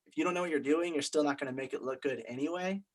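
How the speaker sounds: ordinary talk, nothing marked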